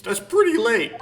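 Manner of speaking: high, loud exclamation; indignant outburst. Acted. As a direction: blurt it out loudly in protest. Character reacts to host's lateness.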